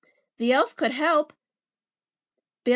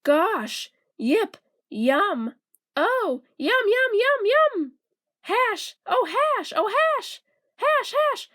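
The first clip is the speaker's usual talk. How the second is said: squealing high with delight; an elf overjoyed at the taste, pitch soaring; excited yelling; 8 semitones higher, much slower than usual. A narrator's reading. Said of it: "he was yelling".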